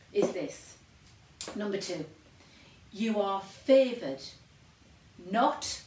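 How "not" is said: NOT in capital letters; loud, standalone NOT, set off by pauses; emphatic NOT. Real, not acted. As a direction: hit NOT hard, all by itself. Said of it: "in capital letters".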